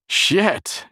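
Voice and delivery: loud, high burst of fury; furious yell. A narrator's reading. Jerry to the boy after the near accident, furious yell. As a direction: explode at the boy in anger. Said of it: "he yelled at the boy in fury".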